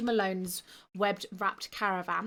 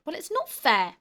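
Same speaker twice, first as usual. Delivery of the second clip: high, loud bark of protest; barking complaint; 7 semitones higher, 6 dB louder than usual. Acted. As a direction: bark it out, stung. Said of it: "Groner barked".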